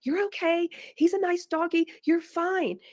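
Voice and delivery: chirpy "you're fine" that waves the fear away; mock parent brushing off a scared child. Shown as a demonstration. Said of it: "we're like".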